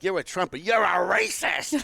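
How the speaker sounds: mocking the protesters. Imitating rally protesters jeering at him, in a high drawn-out mock jeer.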